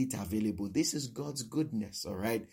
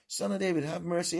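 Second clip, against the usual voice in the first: high-pitched cry for mercy; acted scream; 8 semitones higher, faster than usual. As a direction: cry out to be noticed. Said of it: "And he screams".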